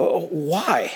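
normal talking voice

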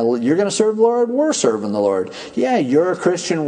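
ordinary delivery

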